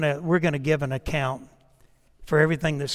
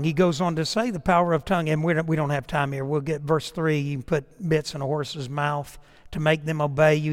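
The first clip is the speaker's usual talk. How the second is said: ordinary talk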